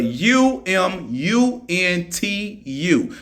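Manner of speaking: U M U N T U spelled slowly, one letter at a time. Shown as a demonstration. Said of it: "I'm not even gonna try to pronounce that".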